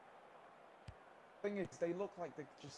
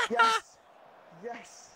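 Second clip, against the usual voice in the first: very high, very loud goal scream; triumphant yell; 10 semitones higher, 15 dB louder than usual. Real, not acted. His team scores.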